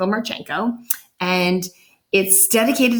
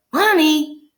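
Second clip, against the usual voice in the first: a little louder; 9 semitones higher, 5 dB louder than usual. A narrator's reading. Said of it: "Luke said a little louder".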